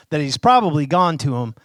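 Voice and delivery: ordinary talk, nothing marked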